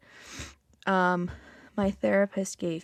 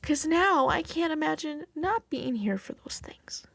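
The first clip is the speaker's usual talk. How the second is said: teary-eyed; 6 semitones higher than usual. Real, not acted. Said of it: "I got a little teary-eyed there".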